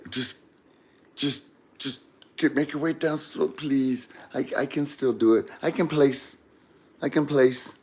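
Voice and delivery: soft, halting self-encouragement; quiet, hesitant begging; hushed pleading. Retold or imitated. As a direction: plead under your breath. Quiet pleading while edging along.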